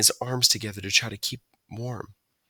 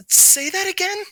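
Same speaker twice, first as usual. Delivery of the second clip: delighted request; 5 dB louder than usual. A narrator's reading. Excited Stuart.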